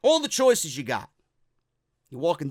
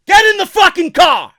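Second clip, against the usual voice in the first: higher, loud barked order; mock angry order; 10 semitones higher, 14 dB louder than usual. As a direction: yell it at the wife. Kurt snapping at wife.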